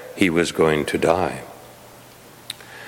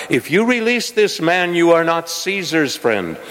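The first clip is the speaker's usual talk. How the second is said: higher, raised crowd cry; 8 semitones higher than usual. A narrator's reading. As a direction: call it out as an angry mob.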